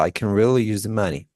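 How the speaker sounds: short "can" as /kən/; "can" squeezed small in the sentence; reduced can. Shown as a demonstration. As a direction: clip "can" so it almost vanishes. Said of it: "Remember the short".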